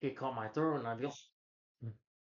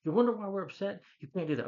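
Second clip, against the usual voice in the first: higher and louder, exasperated telling-off; upset friend scolding Andrew; angry scolding; 8 semitones higher, 6 dB louder than usual. Retold or imitated. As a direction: snap back at him in disbelief. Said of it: "It's like".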